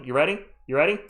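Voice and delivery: ordinary talk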